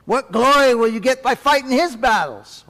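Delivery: the soldier jeering at Paul; mocking soldier. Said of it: "the Roman soldier says".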